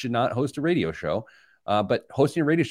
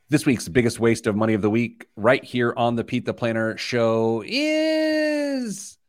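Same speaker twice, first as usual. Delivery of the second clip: announcer voice; 3 semitones higher, slower than usual. Acted. Announcing the segment title.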